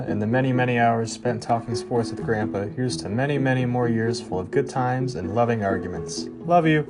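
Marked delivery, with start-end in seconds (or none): none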